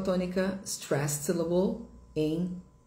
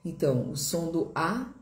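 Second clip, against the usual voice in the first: ordinary talk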